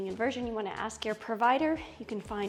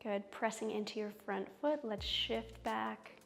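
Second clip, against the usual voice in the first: soothing yoga teacher; 8 dB quieter, slower than usual. Acted. Guides yoga poses slowly.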